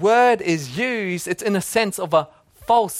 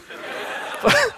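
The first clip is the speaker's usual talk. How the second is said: high airless burst of laughter; silent laugh. Real, not acted. Pastor recalls watching the kids, bursting into silent laughter. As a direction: laugh with no voice, almost a squeak. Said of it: "I laughed".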